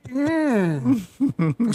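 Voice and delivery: high, strained closed-mouth hum; mock hum of forcing down food; imitated disgusted mm. Imitating his date struggling with sushi, disgusted mm. Starts making this face.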